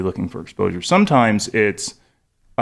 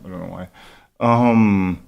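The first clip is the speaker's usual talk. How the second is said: ordinary delivery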